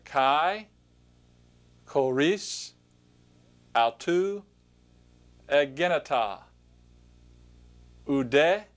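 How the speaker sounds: slow word-by-word Greek pronunciation, each word set apart; reading Greek aloud. Shown as a demonstration. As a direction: pronounce each Greek word clearly for learners to repeat. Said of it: "point to the word and just pronounce it".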